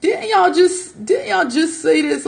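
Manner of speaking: high, slow drawn-out accusation; slow sarcastic retort. Retold or imitated. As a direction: stretch it out, pointed. Retort to being called killer.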